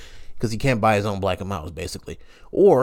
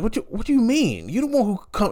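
high indignant; 11 semitones higher than usual. Retold or imitated. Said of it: "I was like".

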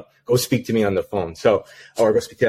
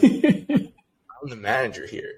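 slow, higher, laughing through the words; 4 semitones higher, much slower than usual. Retold or imitated. New manager answering the irate caller, laughing. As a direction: chuckle it out in disbelief.

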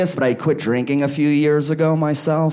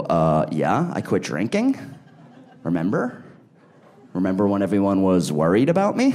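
lower, sheepish mock explanation; sheepish answer; 7 semitones lower than usual. Uncle explains to his niece he quit drinking, sheepish and lower. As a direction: answer the kid, deflated and defensive. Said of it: "I go".

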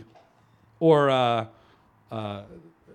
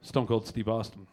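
ordinary talk

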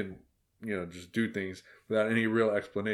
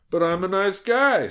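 high, louder wounded objection; pleading protest; 10 semitones higher, 5 dB louder than usual. A narrator's reading. Wolf defends himself to the girl boss, protesting. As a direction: protest your innocence in a raised voice.

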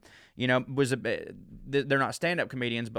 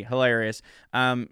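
ordinary talk

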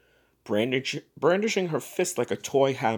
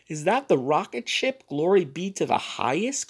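appalled; 5 semitones higher than usual. Acted.

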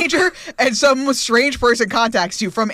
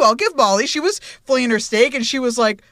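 ordinary talk, nothing marked

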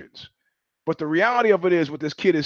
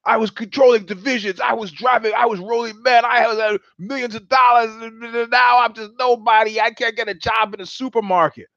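raging Rambo; 5 semitones higher than usual. Retold or imitated. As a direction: rant like a broken-down war hero. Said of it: "hence the rage of Rambo".

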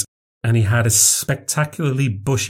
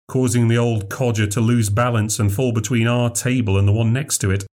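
ordinary delivery

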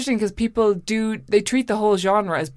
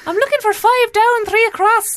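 a hammed-up Scottish old man voice, pitched way up; bad Scottish accent; 7 dB louder than usual. Retelling the prank caller's voice, a hammed-up Scottish accent. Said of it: "I can't do a Scottish accent".

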